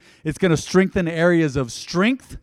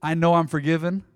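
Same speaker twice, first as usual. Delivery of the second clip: quiet prayer; 5 semitones lower, 6 dB quieter than usual. Acted. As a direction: lower and much softer prayer.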